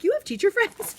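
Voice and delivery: mock the children's disbelief; kids teasing. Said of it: "they're like".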